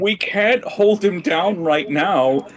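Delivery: slow, high-pitched protest. Acted. Player objecting to holding him down, protesting. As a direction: stretch each word out in a high, insistent protest.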